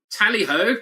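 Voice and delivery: high, loud rallying tally-ho; playful imitation of a player's shout; imitated shout. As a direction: call it out like a battle cry. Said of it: "I heard him shout that".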